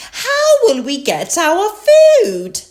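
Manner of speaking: high, loud mother pig voice; Mummy Pig. A narrator's reading. Mummy Pig asks about food, voiced high and loud. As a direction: raise the pitch for a storybook mum. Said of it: "Mummy Pig said".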